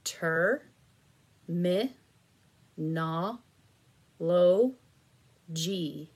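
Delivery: drawn out syllable by syllable, very slow; split into syllables. Shown as a demonstration. Teacher sounds out syllables for students to blend, very slow. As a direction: stretch every syllable apart for the child. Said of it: "squish together these syllables".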